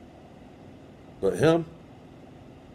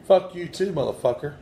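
higher, indignant curse back; angry retort; 6 semitones higher than usual. Real, not acted. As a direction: spit the insult right back. Cursing back at spirit.

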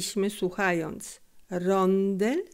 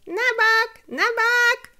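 high, loud shout to clear the way; yelling; 7 dB louder, slower than usual. A narrator's reading. Boy shouting at traffic, loud yell. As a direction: bellow it at the street. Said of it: "a Felek tak wrzeszczał".

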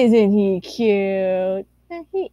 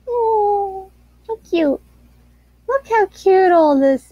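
cutesy coo; 8 semitones higher, 4 dB louder, slower than usual. Acted. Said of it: "Ladle, why are you talking like this?".

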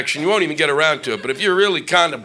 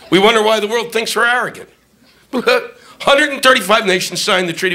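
usual speaking voice

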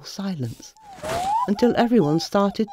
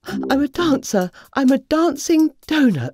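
higher, louder insistence; indignant; 8 semitones higher, 5 dB louder than usual. Acted. Dorothy insisting she is a dancer, indignant. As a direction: insist proudly.

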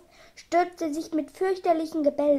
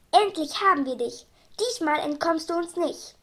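plain reading voice

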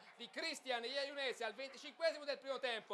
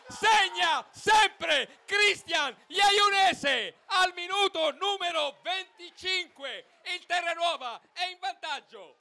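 screaming goal call, high and loud; breathless italian goal commentary; 10 semitones higher, 20 dB louder, much slower than usual. Real, not acted. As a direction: erupt with joy at the goal. Goal scored, commentator erupts.

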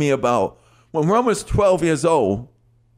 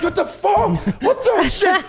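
stunned reaction. Retold or imitated. Kid hearing [name] for the first time, stunned. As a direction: blurt it out floored. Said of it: "And I'm like".

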